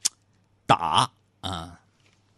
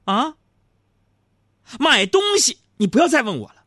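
mock-furious high, loud tirade; yelling at wife; 6 dB louder than usual. Husband scolding wife over buying cosmetics, mock-angry yelling. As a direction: roar like a husband laying down the law, hammed up. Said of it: "你就得像我这么吼他".

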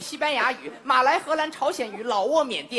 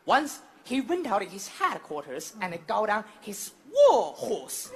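mock-grand English narration, lower pitched; English-language storytelling; 4 semitones lower than usual. Shown as a demonstration. Comic retelling the Zhang Fei tale in English, mock-epic storyteller. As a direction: announce the tale with slow, measured weight. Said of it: "得用英语说呀".

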